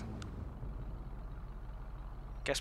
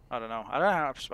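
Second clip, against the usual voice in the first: ordinary talk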